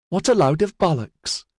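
plain reading voice

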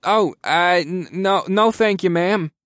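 high, flustered stammer. A narrator's reading. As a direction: trip over a polite refusal. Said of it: "Taylor stammered".

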